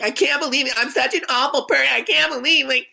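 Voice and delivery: high put-on whiny woman's voice; mocking the heckler. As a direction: whine it out like a sulking woman being thrown out. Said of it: "just talking shit".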